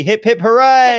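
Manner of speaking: loud, high celebratory cheer. Real, not acted. Host leading three cheers for Ruby, cheering. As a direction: lead a rousing cheer.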